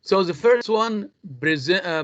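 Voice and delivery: normal talking voice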